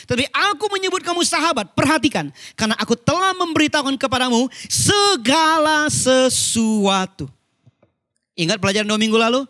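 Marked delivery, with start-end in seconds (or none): 0.1-7.3 raised, emphatic scripture recital; preach. Real, not acted. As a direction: proclaim the verse with fervour. (mewartakan ayat dengan nada naik)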